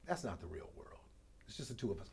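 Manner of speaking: low, hushed, near-whispered confidence; confiding aside. Retold or imitated. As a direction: drop to a hush, just between two. Confiding advice to his son.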